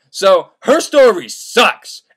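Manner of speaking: slow, dragged-out sucks; slow comic summary; drawn-out verdict. Real, not acted. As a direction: drag the words out slowly. Summing up with a drawl.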